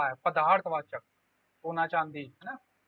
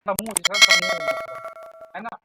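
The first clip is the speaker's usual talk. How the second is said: emphatic stress; 9 dB louder, much slower than usual. Real, not acted. Teacher stresses the key word.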